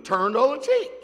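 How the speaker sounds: loud, rising mock exasperation; exasperated. Preacher answering the doubter's "what are you gonna do", exasperated. Acted exasperated reply to repeated question.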